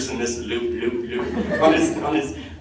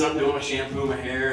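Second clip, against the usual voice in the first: ordinary talk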